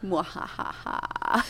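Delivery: whispered mock evil cackle; a hammed-up villain chuckle; mad scientist laugh. Evil laugh after bad joke.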